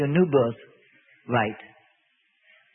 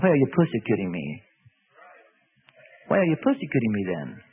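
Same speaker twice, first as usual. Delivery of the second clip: voice of Jesus; 10 semitones lower than usual. Acted.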